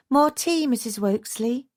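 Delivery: housekeeper cutting in. A narrator's reading. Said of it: "Missus Treacle cut in".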